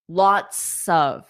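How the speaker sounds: ordinary delivery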